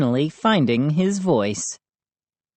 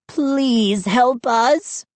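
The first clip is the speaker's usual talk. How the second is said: very slow, much higher tearful plea; begging; 9 semitones higher, much slower than usual. A narrator's reading. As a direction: beg through tears, drawn out. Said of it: "Chip begged".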